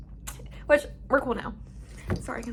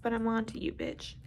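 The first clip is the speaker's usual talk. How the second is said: quiet threat; 2 semitones lower, 9 dB quieter than usual. Real, not acted. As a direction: mutter it darkly. Soft menacing aside.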